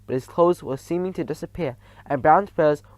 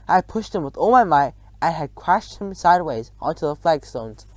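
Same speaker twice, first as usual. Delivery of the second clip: ordinary delivery, nothing marked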